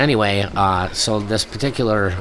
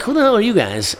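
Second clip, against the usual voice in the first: mock challenge; 11 semitones higher than usual. Friends retorting.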